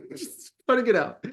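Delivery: giggling through the words; high, laughing tease. Real, not acted. Teasing co-host, laughing.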